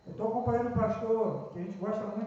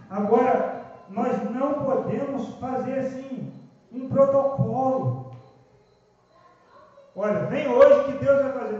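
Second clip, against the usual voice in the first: louder, slow, hammering sermon lines; fiery preaching; 4 semitones higher, 9 dB louder, slower than usual. Acted. Pastor warning the church against protocols, preaching loudly. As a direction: drive each phrase home to the congregation.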